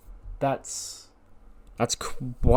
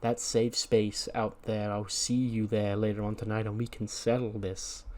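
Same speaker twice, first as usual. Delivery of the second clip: calm, measured menace; 4 dB quieter than usual. Acted. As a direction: answer a threat gently, with long pauses. Calm leader replies to a threat.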